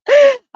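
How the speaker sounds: sudden shrieking burst of laughter; loud high laugh. Real, not acted. Teacher shrieks with laughter at her own joke.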